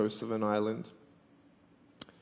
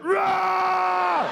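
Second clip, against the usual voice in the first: a very high, loud mock monster roar; 6 dB louder than usual. Speaker roars at the frightened girl, mock monster. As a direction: roar at her like a playful monster. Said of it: "I went".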